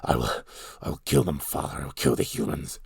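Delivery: imitating the actor's deep gravelly ape voice; deep gravelly villain. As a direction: sneer it deep and gravelly like a hammy panto villain. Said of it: "He talks like this all the time, with his deep, gravelly voice".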